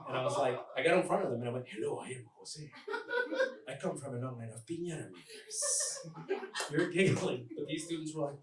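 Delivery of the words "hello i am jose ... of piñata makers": put-on Mexican accent, earnest introduction; fake Mexican accent. Student pranking his speech class, fake Mexican accent. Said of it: "We know your accent's fake".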